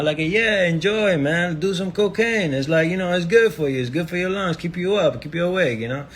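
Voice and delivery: high, loud put-on French accent; mock French accent. Man urging a friend to enjoy life, mock French accent. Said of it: "I don't know why I'm doing a French accent".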